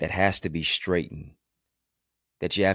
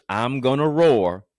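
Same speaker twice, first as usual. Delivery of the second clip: loud, raised, stretched-out declaration; emphatic roaring vow; 7 semitones higher, 8 dB louder, slower than usual. Real, not acted. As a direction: build to a big, drawn-out roar of resolve. Building vow to roar.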